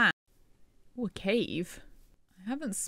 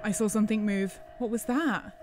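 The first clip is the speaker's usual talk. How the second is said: normal talking voice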